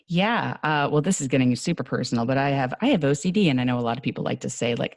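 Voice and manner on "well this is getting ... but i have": ordinary talk, nothing marked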